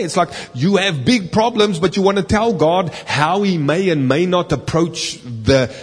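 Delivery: usual speaking voice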